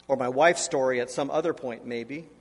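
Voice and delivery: ordinary delivery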